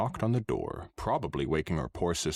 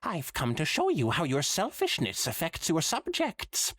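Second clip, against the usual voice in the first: high, hammy Trottingham spirit voice; a hammy Trottingham ghost, pitched high; bad Trottingham accent; 10 semitones higher than usual. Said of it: "in the bad Trottingham accent".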